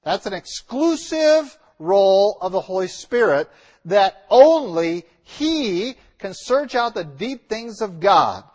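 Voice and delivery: ordinary delivery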